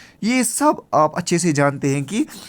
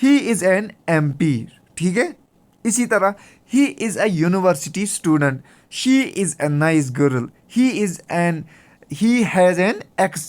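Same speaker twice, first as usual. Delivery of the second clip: slow example reading; much slower than usual. Shown as a demonstration. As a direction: read each English example out very slowly, word by word. Reads English example sentences aloud. (धीरे-धीरे उदाहरण वाक्य पढ़ना)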